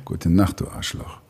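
very low, trailing into near-whisper; film hero one-liner. Acted. Voice actor gives fans the film line, low hero voice. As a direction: deliver it deep, dropping away on the insult.

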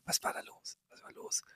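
a hushed, voiceless question; whispering. Retold or imitated. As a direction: whisper it under the engine noise. Wife whispers over plane noise.

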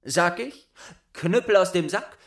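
high, loud ringing spell; boastful son calling the spell; proud demonstration. Acted. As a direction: show off the magic words.

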